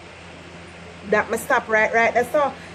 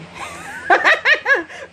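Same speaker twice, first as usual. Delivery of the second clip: high burst of laughter; 10 semitones higher than usual. Real, not acted. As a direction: cackle at the slip. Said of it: "laughing at herself".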